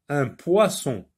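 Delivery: ordinary talk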